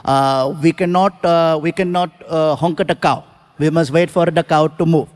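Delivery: put-on low Indian accent, unhurried; Indian driver. Driver voiced in story.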